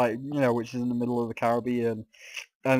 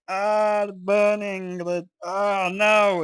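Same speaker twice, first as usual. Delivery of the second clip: mock agony in the heat; 6 semitones higher, slower than usual. Complaining about the heat to viewers, a mock anguished wail. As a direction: ham up roasting alive. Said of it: "You're like".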